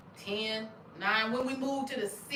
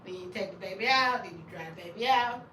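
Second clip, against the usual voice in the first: ordinary talk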